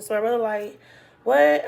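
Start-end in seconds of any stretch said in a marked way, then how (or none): 1.2-1.6 sudden, loud, high what; a brother's startled loud what. Retold or imitated. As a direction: snap it out like a shocked brother. Brother reacts to the news.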